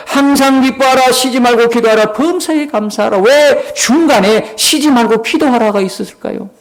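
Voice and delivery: high-pitched, insistent sermon delivery; forceful preaching. Real, not acted. Preacher challenges congregation about treating prayer like a bill, forceful exhortation.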